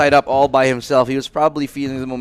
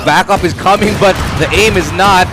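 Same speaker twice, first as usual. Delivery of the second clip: excited; 5 semitones higher, 5 dB louder than usual. Real, not acted. Caster calls out the final fight.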